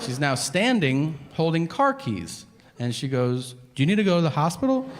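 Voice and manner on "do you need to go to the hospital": quick, raised-pitch urgent question; concerned wife. Retold or imitated. Wife to husband leaving the bathroom, concerned. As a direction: imitate a worried wife, words rushed.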